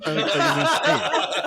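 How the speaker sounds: slow, laughing high exclaim; cracking up. Real, not acted. Laughing at the videos.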